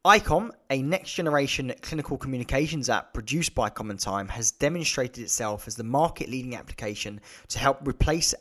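steady, polished advert read; sponsor ad read. Acted. Host thanking the sponsor for listeners, polished ad read. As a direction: sell the sponsor briefly and cleanly.

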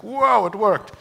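high amazed whoa; amazed kid. Retold or imitated. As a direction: gasp like a kid in wonder. Kid amazed remote starts car.

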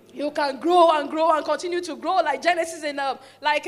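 ordinary talk, nothing marked

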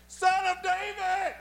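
high, slow, far-carrying call; crying out. Acted. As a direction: call out persistently, stretching each word. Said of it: "But he cried out all the more".

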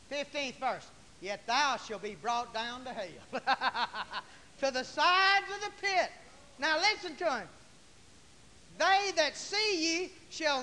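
loud, high, drawn-out scripture reading; forceful preaching. Real, not acted. Preacher declaiming scripture to the congregation, forceful and loud. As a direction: preach the verse out at full force.

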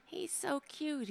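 high, slow coo with a soft laugh; cooing at her pet. Real, not acted. Host cooing softly over her pet lying on his back. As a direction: melt over a cute pet.

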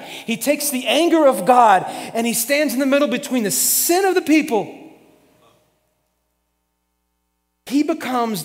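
loud, high, driving sermon delivery; full-force preaching. Acted. Pastor preaching to the church about Aaron in the gap, loud and driving. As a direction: lift the church as Aaron steps in.

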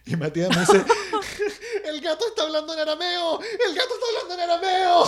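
squealing with laughter, high and loud; cracking up while telling the punchline; high, loud laughter through the words; laughing hard. Real, not acted. Laughing retelling of panic.

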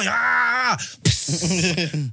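high, loud cry of relief then a hiss; mock relief groan into a hiss; relieved gasp and hiss. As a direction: let out the relief sigh and pee hiss. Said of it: "You know how you go".

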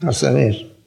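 a hint of a watchful cop; low, soft, lightly acted snooping cop; mock policeman. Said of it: "no anda así como policía". (policía vigilante, apenas imitado)

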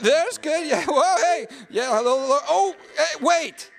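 high, giddy flustered bursts; flustered yelps. Acted. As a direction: yelp as unseen hands touch you. Startled by invisible woman's touch.

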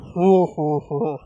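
low, drawn-out ho ho ho; slowed-down laugh. Shown as a demonstration. Host imitating a slowed-down laugh recording, mock. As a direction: mimic a laugh looped and slowed. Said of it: "So it'll be like".

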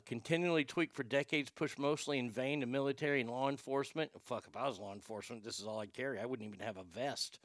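ordinary delivery

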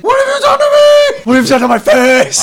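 high screaming horror cry; anguished shout. Acted. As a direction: scream it at your own reflection. Acted horror scream quote.

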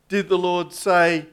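ordinary talk

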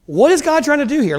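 normal talking voice

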